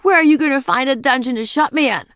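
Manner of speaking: high, loud, fast challenge; defiant princess. A narrator's reading. Princess Ramona challenging the witch, loud defiance. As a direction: throw the question back defiantly. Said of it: "Ramona was a defiant princess".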